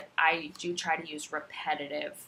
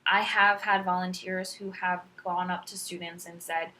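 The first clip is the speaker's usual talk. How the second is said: normal talking voice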